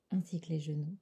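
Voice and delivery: ordinary talk, nothing marked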